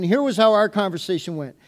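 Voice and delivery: normal talking voice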